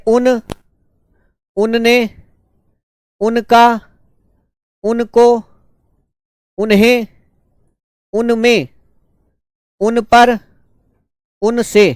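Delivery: slow, spaced dictation with a pause after each word. Acted. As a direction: call out each word clearly so the students can write it. Dictates word forms to students.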